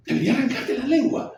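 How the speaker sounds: high, furious threat; irate. Acted. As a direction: threaten him in a burst of rage. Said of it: "él responde iracundo".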